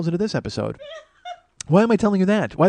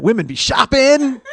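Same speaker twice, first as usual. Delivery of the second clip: higher, louder, slow stand-up catchphrase with a laugh; hack comic catchphrase; 9 semitones higher, 6 dB louder, slower than usual. Shown as a demonstration. Host mocking a hack comedian catchphrase, hammed up. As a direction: ham up the old-comic line. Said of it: "some people will know what that's from".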